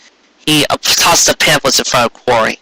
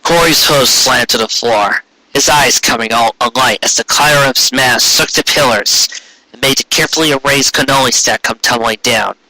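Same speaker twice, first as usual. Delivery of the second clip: ordinary delivery, nothing marked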